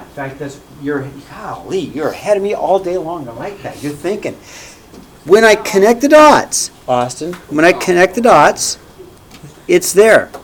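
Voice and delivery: normal talking voice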